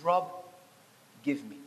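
quietly invite, pausing after the name; gentle request. Retold or imitated. Jesus holds out his heart to Rob, gentle request.

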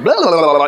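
high, loud burble of mock chatter; babbling crowd imitation. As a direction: gabble like a noisy Italian crowd. Imitates noisy crowd of Italians.

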